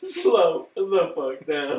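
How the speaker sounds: loud, slow, laughing mock order; mock shouted command. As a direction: stretch it out high, laughing through the yell. Joins in as the bossy car.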